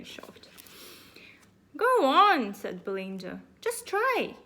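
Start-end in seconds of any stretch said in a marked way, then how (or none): 1.8-4.3 put-on urging girl. Belinda urging, loud.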